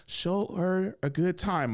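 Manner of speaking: lightly voiced mom urging him on; a hint of mom's voice in his head, slow. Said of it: "My mom talking to me".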